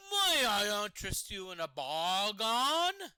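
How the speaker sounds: slow, loud, sly shadowy dealer; smooth shady peddler; mysterious dark merchant. Acted. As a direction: tempt them with a shady offer, slowly. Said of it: "mysterious dark man appears from out of the shadows".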